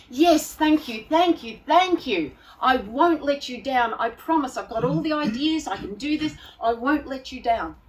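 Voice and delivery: gushing thanks. Retold or imitated. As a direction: gush your thanks eagerly.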